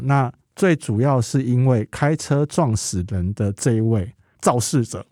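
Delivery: normal talking voice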